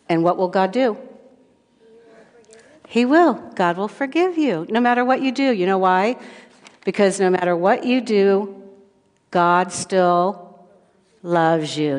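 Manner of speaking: ordinary delivery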